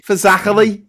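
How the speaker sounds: Scouse demonstration of Fazachely; Scouse accent. Shown as a demonstration. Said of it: "best word to say in a Scouse accent".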